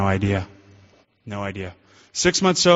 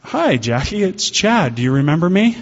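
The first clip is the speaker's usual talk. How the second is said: higher, eager hello; nervous bright greeting; 6 semitones higher, 4 dB louder than usual. Retold or imitated. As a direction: blurt it out, pitched up and eager. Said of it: "my mouth went".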